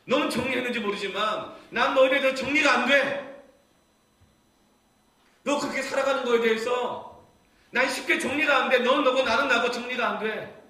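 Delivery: high, loud cry of anguished love; impassioned preaching. Acted. Preacher voices God's burning love for his people, impassioned.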